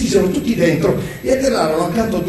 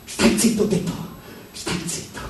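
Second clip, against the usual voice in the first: hushed angry command; 8 dB quieter than usual. A narrator's reading. Quarrel, command repeated under breath.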